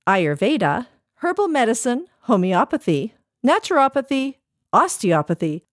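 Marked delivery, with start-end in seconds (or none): none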